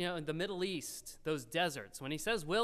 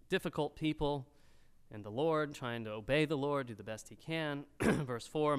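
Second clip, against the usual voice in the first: ordinary talk, nothing marked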